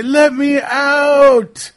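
high, drawn-out wailing shout; slow, high, hammed-up cry for help; trapped man's plea. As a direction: beg desperately to be let out. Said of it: "and say".

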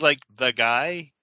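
ordinary delivery

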